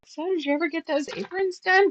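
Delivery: chirpy customer-service curiosity; cheery question. Retold or imitated.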